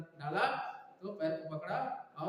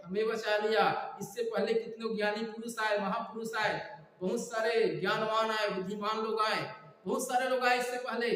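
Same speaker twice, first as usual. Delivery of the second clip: higher, insistent sermon delivery; forceful preaching; 4 semitones higher, 4 dB louder than usual. Real, not acted. Preacher contrasts wise men who came before, forceful preaching. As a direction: drive each phrase home to the congregation.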